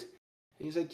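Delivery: ordinary talk, nothing marked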